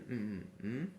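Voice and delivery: short hums ending on a rise; humming for a blank. Shown as a demonstration. Teacher asking pupils for the hidden word, humming. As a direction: hum the missing word questioningly.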